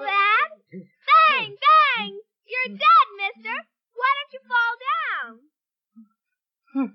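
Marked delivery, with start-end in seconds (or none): 0.7-3.1 put-on high, loud childish bangs; gleeful play gunshots. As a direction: shout the bangs like a kid playing cowboys. Girl shooting game.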